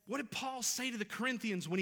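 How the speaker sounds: preacher building to a loud declaration; impassioned preaching. Real, not acted. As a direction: hammer each phrase home to the congregation. Preacher builds to the sermon's main point.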